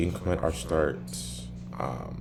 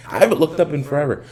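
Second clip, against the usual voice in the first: loud; 10 semitones higher, 10 dB louder, faster than usual. Real, not acted. Sudden loud remark to camera.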